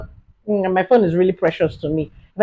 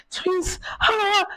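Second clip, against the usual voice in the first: high, soft stunned word; dazed; 12 semitones higher, 10 dB quieter than usual. Retold or imitated. Telling the assistant's news again, high soft daze. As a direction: breathe it out in shock.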